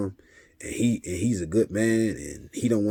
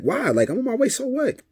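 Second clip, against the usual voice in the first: high-pitched, louder exasperated retort; exasperated comeback; 5 dB louder than usual. Retold or imitated. Dad replying to nagging texts on his drive over, exasperated. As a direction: fire back as if pestered on the phone.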